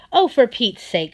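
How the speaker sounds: loud exasperated sigh of words. Acted. Brownie giving in to the crying fox, exasperated. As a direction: give in with fed-up impatience.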